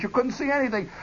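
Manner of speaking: ordinary talk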